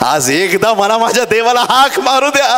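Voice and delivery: building to a high, loud cry, then easing; impassioned preacher crying out as the beggar; desperate pleading. Acted. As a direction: beg at the top of your voice to be heard. Beggar begs to cry out, acted.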